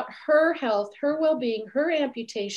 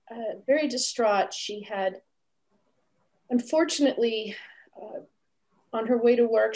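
normal talking voice

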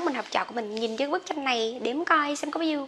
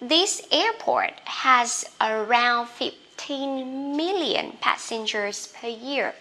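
slow model sentence; much slower than usual. Shown as a demonstration. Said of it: "teacher models sentence slowly".

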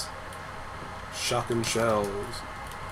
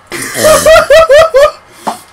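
shrieking laugh; high hysterical laugh; 11 dB louder than usual. Real, not acted. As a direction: cackle high and loud. Laughs after scare.

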